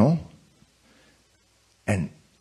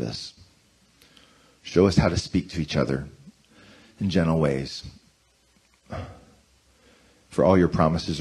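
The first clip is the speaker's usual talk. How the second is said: prayer mode; 8 semitones lower, 4 dB quieter than usual. Acted. Pastor leading church in prayer, reverent and gentle. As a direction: pray it aloud, gently, for all.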